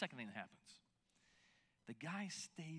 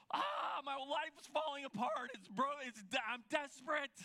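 hammed-up wail of a life falling apart, higher and louder; mock overdramatic despair; 7 semitones higher, 4 dB louder than usual. Pastor mocking himself asking for prayer, hammed-up despair. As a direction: overplay the despair as a joke. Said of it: "I don't wanna be like".

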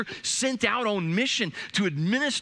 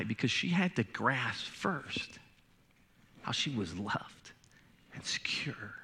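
much softer, fading into a whisper; hushed; 3 semitones lower, 7 dB quieter than usual. Acted. Pastor to congregation, on the daughter feeling loved, fading to a whisper. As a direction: let it sink in quietly.

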